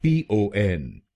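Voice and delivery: पीओएन spelled slowly letter by letter; पीओएन drawn out letter by letter; slow spelling out. Shown as a demonstration. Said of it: "दूसरा शब्दांश है".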